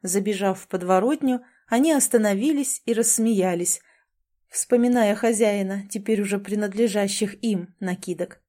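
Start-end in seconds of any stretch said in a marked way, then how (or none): none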